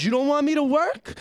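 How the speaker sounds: high, rising indignant question; incredulous challenge. Retold or imitated. Employee challenging his disrespectful boss, incredulous. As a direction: throw it back as a disbelieving question.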